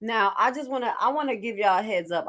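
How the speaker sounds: ordinary talk, nothing marked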